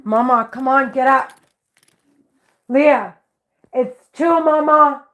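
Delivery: urgent loud wake-up call. Real, not acted. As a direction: call her urgently out of bed. Calling daughter out of bed.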